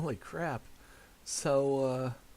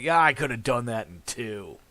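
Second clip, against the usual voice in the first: louder, smug dad voice; imitating father; 8 dB louder than usual. Son mimicking boastful father's voice, smug. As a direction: brag it like a know-it-all father. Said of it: "his voice is like".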